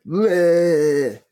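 a nasally bleating taunt to the critics; mocking whine. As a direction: sneer it like a sulky online troll. Mocking online critics' whining.

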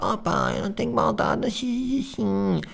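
put-on very high sweet babyish plea; cutesy girl voice. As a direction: whine sweetly. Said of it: "dizer aos outros". (voz de fofinha muito aguda)

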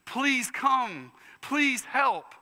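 a mother crying out to the Lord for her daughter, desperate plea. Acted. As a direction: beg the Lord for help in desperation. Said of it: "It is a".

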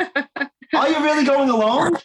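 high, slow disbelieving question; incredulous. Real, not acted. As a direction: ask it in disbelief.

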